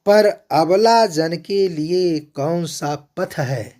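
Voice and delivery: lifted, declamatory verse reading; poem recitation. Acted. Lecturer reciting Kaikeyi's verse, declaimed.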